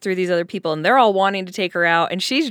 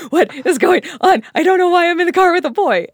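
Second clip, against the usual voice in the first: a higher, hammed-up panic; mock freaking out; 8 semitones higher, 3 dB louder than usual. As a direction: play it as a frantic first car ride. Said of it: "freaking out in the car".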